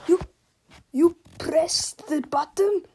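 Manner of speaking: loud, shocked scream; screaming in disbelief. Acted. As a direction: scream at the madness. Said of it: "screamed Super Mega Tippy incredulously".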